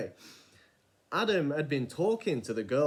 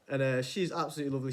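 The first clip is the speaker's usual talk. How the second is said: normal talking voice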